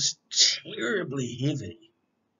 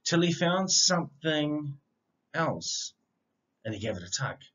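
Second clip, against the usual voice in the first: bouncy rhyming read-aloud, opening lines louder; picture book reading; 7 dB louder than usual. A narrator's reading. Reading a rhyming dog story aloud to children, lively storyteller voice. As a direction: read it like a bedtime story for kids.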